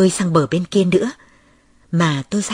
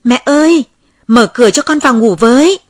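loud, higher call through the door; calling louder; 6 semitones higher, 8 dB louder than usual. Acted. Child calls again louder at the locked door, pleading. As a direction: call louder so mother hears. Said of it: "Tôi gọi to hơn".

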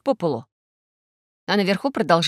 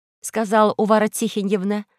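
ordinary delivery, nothing marked

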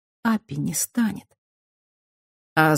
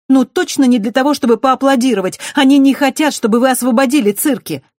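put-on high, loud sharp girl's warning; sarcastic girl; 9 semitones higher, 7 dB louder than usual. A narrator's reading. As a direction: warn them with a jab. Dukati warning urgently. (высоким голосом, с сарказмом)

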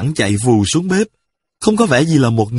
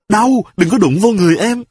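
crying out in pain; 9 semitones higher than usual. A narrator's reading. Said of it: "cô ta la lên".

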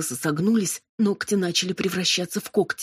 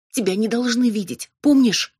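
higher-pitched urgent reminder; urgent heroine; 5 semitones higher, 5 dB louder than usual. A narrator's reading. Robin holding back her demon, urgent warning. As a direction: warn him urgently, pitch lifted.

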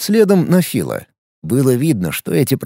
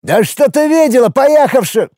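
heated shout; 8 semitones higher than usual. Acted. Woman and Phil quarrel.

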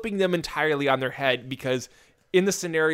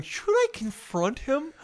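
put-on timid girlfriend voice; 9 semitones higher, 4 dB quieter, slower than usual.

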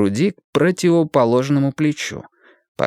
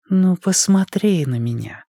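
sultry woman; 8 semitones higher than usual. A narrator's reading. Kuzya seductive.

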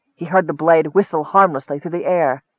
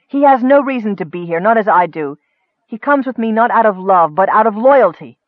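raised, heated defence; angry retort; 5 semitones higher, 5 dB louder than usual. A narrator's reading. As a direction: press the point in a higher, angry voice. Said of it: "Nicole retorted angrily".

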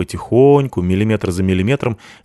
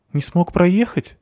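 wife voice; 10 semitones higher, slower than usual. A narrator's reading. Wife asks, higher, slow.

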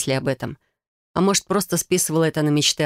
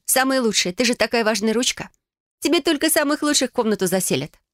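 higher, playful coaxing; coaxing sister; 7 semitones higher than usual. Acted. Sister flattering the important door handle, playful. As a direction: humour a sulking pet.

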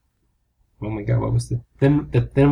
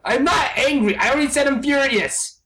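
angry actor insisting he is furious, not angry; 8 semitones higher than usual. Acted. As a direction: bellow the denial. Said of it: "I'm fucking furious".

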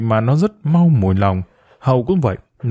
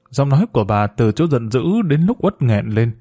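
ordinary delivery, nothing marked

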